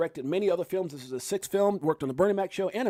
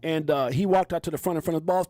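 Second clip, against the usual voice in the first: ordinary delivery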